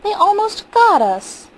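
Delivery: high, loud, slow cry of horror; wife crying out in fright; terrified cry. Acted. Terrified wife after lions charged.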